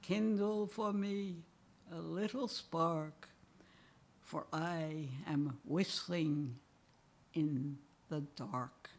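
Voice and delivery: poem reading. Acted. Aging poet reads his poem Catalog to the audience, rhythmic and wry. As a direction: give each couplet its beat, then ease into mourning.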